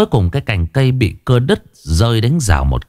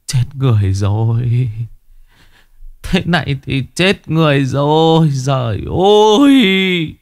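long drawn-out wail, rising high; horrified lament; 5 semitones higher than usual. A narrator's reading. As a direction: moan out the disaster, stretching the last words. Worker collapses seeing the death.

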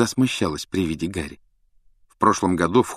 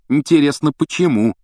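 higher, louder needling question; snide; 7 semitones higher, 6 dB louder than usual. A narrator's reading. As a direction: needle them with mock curiosity. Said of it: "съязвил Рон".

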